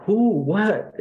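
loud, high one-word demands; recalled shock, voice jumping up; shocked questioning. Retold or imitated. Reliving shock at brother's killing.

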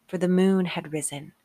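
usual speaking voice

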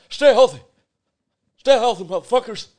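high, loud catchphrase shout. Acted. Podcaster trying out his sign-off as a loud shout. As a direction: belt out "stay healthy" like a slogan. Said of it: "Maybe that's my thing".

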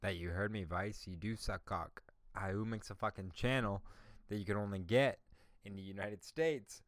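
ordinary talk

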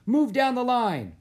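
high, loud shouted order; loud coach's shout; coach calling out. A narrator's reading. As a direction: holler an order down the field. Coach orders Jack across field.

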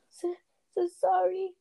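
high, slow sobbing giant's voice. A narrator's reading. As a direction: blubber it out like a big man crying. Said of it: "sobbed Hagrid".

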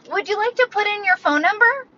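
imitating the cashier. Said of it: "and she goes".

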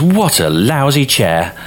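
mild storybook bellow. A narrator's reading. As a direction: bellow it slowly as a cross little girl. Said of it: "She bellows".

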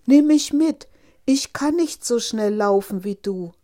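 loud frog shouting after the runaway princess; calling after. A narrator's reading. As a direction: shout out high, then plead at full volume. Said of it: "rief der Frosch". (laut und flehend hinterherrufend)

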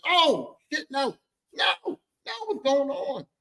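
high, stretched-out alarm; a kid watching his dad get cursed; shocked kid. Retold or imitated. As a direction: panic watching it unfold. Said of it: "I'm just like".

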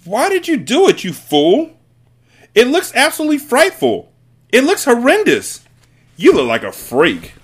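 very high, loud, berating tirade; appalled mother's piercing scolding; shrieking. A narrator's reading. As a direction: shriek at the husband in horror. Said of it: "shrieked the mother".

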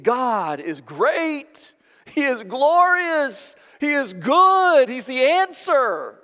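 God is great, drawn out slow and loud; slow emphatic proclamation. Acted. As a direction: land every word like a creed. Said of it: "able to communicate".

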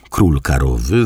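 ordinary delivery

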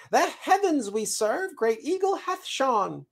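very high, lofty chirp; grand squirrel messenger. Acted. Squirrel returns to the seed with the eagle's word, grand chirp.

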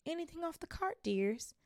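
put-on high, sweet old lady voice; a kindly cooing trolley witch; lightly voiced sweet old woman.

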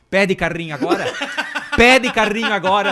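loud, rising mock scolding with a laugh; mother smacking and scolding; laughing mock yell of an angry mom. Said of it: "ela falava".

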